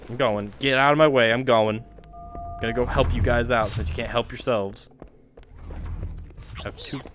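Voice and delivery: usual speaking voice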